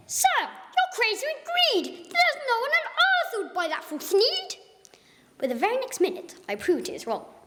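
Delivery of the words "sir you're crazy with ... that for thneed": wag a finger at the greedy man; scolding Lorax. Acted. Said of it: "The Lorax said".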